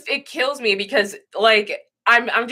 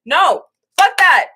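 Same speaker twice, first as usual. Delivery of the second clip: defiant; 6 semitones higher, 7 dB louder, slower than usual. Real, not acted. Defiant rejection of hair pressure.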